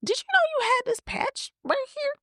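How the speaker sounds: very high-pitched voice for a quoted hairdresser; high imitation of a questioning hairdresser; imitating hairdresser. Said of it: "she's like".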